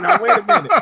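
high, loud squeal of mock shock; a startled diva stopping everything in its tracks. On entering theater school, a high loud shocked squeal. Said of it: "I was like".

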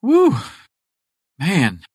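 high loud woo, then a tired man; whoop, then sag; breathless woo. Retold or imitated. Winded fisherman whooping on the cooler. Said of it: "and he goes".

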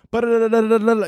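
high rapid ba-da-da babble; fast dubbed lip babble. Retold or imitated. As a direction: rattle off ba-da-da like fast foreign speech. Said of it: "super fast".

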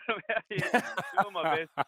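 laughing through the words; chuckling while talking. Real, not acted. Laughs at own joke.

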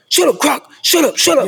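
high, loud "shut up" yelled at a player; angry yell. Retold or imitated. A coach yelling at Crocker in the meeting, mock angry shout. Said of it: "another coach was like".

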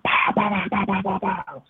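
rapid high pow-pow bursts; imitated gobbling barrage. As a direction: mimic birds hammering away, rapid-fire. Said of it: "they're still gobbling".

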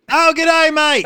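loud, high mock Australian accent; hammed-up Aussie with a dash of London. Podcaster mocks an Australian employer, broad Australian accent. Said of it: "mixture between London and Australian".

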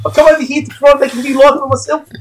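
high, put-on hard-done-by voice; co-host jokes about his struggles in a high put-on voice; mock lament. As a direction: ham up the poor immigrant's complaint.

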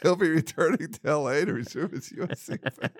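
high-pitched, laughing through the words. Real, not acted. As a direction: reread it while cracking up. Cracks up rereading listener's email.

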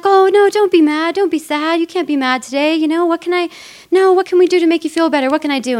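high, loud mock coaxing; hammed-up eager guy begging to cheer her up; pleading flirt.

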